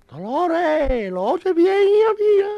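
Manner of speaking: very high, loud wailing call to his dead wife; crying widower. Retold or imitated. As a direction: sob it out to Dolores, rising. (viudo llamando a gritos agudos, llorando)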